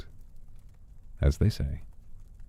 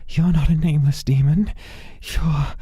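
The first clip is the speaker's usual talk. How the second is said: much higher, louder frantic voice; panicked pleading man; 13 semitones higher, 4 dB louder than usual. Acted. Desperate man begs the demon for his life, high panicked plea.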